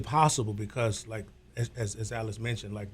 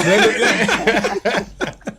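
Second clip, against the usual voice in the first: nervous laugh; 8 semitones higher, 6 dB louder than usual. Real, not acted. Pressured to sing.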